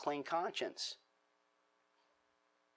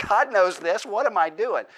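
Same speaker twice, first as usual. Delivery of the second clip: the same question, now louder and firmer, a scolding; louder self-rebuke; 5 semitones higher, 7 dB louder than usual. Retold or imitated. Preacher admitting God already knows, firm self-rebuke. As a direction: scold yourself: what am I doing. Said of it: "It's like".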